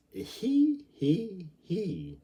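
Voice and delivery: high giggle falling step by step; a mocking little giggle winding down; tittering he he he. Mock giggle in the tale.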